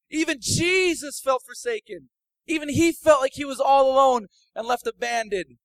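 high, loud pulpit cry; fiery preaching. Acted. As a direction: preach it out to the whole room. Preaching at full force.

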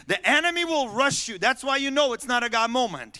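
sudden loud, high-pitched warning; full-force preaching. Real, not acted. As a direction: hammer the warning home to the congregation.

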